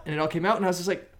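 ordinary talk

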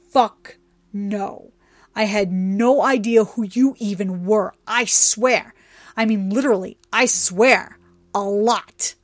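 loud, emphatic protesting; frantic denial. A narrator's reading. Trixie explains herself to an angry goddess, protesting loudly. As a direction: insist forcefully, punching the words.

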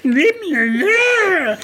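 very high, drawn-out mock wail; imitation of a whiny, crying screamer; whiny crybaby. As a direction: wail it like a bawling baby, mockingly. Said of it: "he's crying like a little baby".

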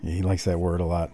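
normal talking voice

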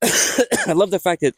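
hiccuping mid-sentence; interrupted by hiccups. Real, not acted. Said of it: "I got the hiccups".

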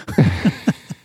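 breathy whispered giggle; whispered laugh. Real, not acted. Laughs after whispered joke.

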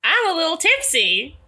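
put-on Texan accent, playing a little tipsy; a mildly tipsy Southern tourist; fake tipsy Texan. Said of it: "we are gonna do the whole episode like this".

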